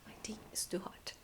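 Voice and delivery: tired soft whisper. Real, not acted. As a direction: whisper it wearily.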